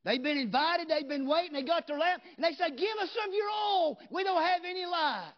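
loud, high, shouting revival preacher; full force preaching. Acted. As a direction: preach it out loud to the whole church.

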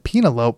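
Penelope said as "PEEN-a-lope"; mispronounced name. Retold or imitated. Moviegoer behind them misreading a name, Penelope as "PEEN-a-lope". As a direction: say Penelope to rhyme with "envelope", long first "ee". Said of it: "the person behind them said".

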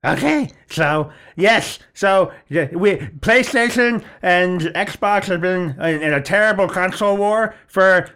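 high, slow clerk impression; mimicked clerk voice. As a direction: pitch it up and drag it like the clerk. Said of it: "I'm gonna try and mimic the voice".

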